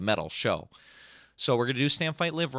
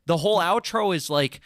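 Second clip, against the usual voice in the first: high, louder, emphatic no; insistent retelling; 9 semitones higher, 3 dB louder than usual. Retold or imitated. Telling his dad the sad ending is the point, emphatic. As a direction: push back hard on the ending.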